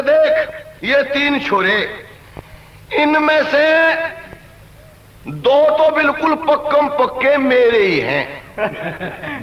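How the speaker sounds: slow, drawn-out declaration; slow pronouncement. Acted. Old man tells wife about their three sons, slow pronouncement. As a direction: spell it out slowly, with long pauses.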